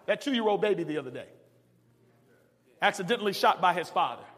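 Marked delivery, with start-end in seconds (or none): none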